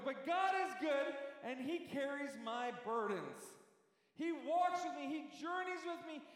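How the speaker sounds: fervent sung-out sermon; preaching. Acted. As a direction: carry the room on a wave of praise. Preaches, half-singing the praise.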